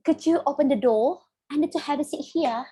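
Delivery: put-on British, Rs dropped in DOOR and HERE; British accent. Shown as a demonstration. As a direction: demonstrate a non-rhotic British accent. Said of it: "kalau mau British". (contoh aksen British tanpa bunyi R)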